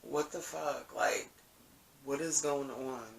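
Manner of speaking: soft, subdued disbelief, recalling feeling down. Retold or imitated. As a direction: quietly recount being let down. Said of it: "really feeling down".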